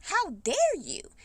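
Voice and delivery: retold high, slow scolding of the church elder. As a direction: stretch out "How dare you?" high and slow in outrage. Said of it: "'Cause I said".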